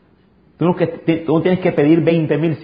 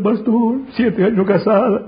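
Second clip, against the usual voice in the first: imitated weeping; 9 semitones higher than usual. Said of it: "literalmente llorando".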